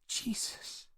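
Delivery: a rasping, barely voiced breath; hoarse exhausted sigh of a word; raspy. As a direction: let it scrape out, spent. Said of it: "a rasp in her voice".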